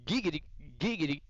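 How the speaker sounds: high giggity catchphrase, climbing as it repeats; Quagmire impression. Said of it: "Family Guy voices".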